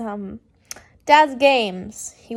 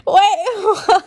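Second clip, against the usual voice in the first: higher, laughing repeat of the question; laughing disbelief; 8 semitones higher, 5 dB louder than usual. Real, not acted. Reader repeating her shock, laughing in disbelief.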